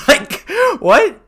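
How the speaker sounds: high, loud disbelieving what; incredulous. Real, not acted. Streamer reacts to the outrage with a high loud disbelieving what. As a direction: explode in disbelief.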